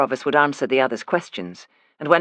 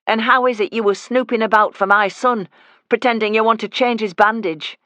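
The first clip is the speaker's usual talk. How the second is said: higher, louder Yorkshire accusation; mother confronts the fake nurse, higher and louder; accusing challenge; 7 semitones higher, 4 dB louder than usual. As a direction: corner the snoop. Woman confronts visitor.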